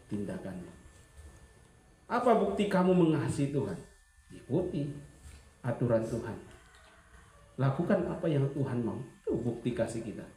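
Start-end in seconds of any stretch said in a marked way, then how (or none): none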